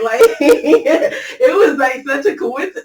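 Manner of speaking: slow words through a laugh that edges on tears; laugh, nearly crying; laughing overwhelmed at the good turn; laughing emotionally. Real, not acted. Overwhelmed by God's timing.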